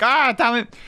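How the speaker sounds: imitated gamer's exasperation; mock frustration. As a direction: act out a small cry of annoyance. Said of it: "and I'm like".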